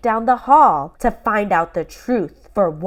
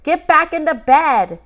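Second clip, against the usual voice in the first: loud, higher stern shout; parents shouting; 8 semitones higher, 6 dB louder than usual. Acted. Parents shout from downstairs, loud and stern. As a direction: yell them back to bed. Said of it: "heard Mom and Dad shout".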